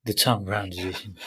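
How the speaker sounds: quiet, playful posh mimicry; mock posh accent. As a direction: copy the affected posh accent. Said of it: "we've gotta speak like this".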